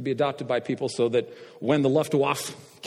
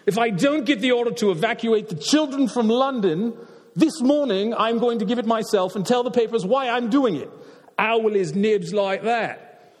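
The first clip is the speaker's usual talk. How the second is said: put-on British accent, firm and insistent, slowing on the last line; 8 semitones higher than usual. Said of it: "I'll put on a British accent here".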